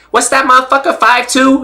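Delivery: very high-pitched boxer impression; Rolly. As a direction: imitate a fighter's incredulous question, voice way up. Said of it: "He goes".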